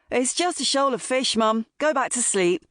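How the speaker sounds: put-on a touch of a teasing boy; son's teasing voice. A narrator's reading. Son telling his mum to go back to sleep, lightly teasing.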